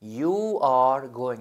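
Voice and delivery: very slow You are going, each word drawn out; slow teacher dictation; slow model sentence. Shown as a demonstration. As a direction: dictate slowly.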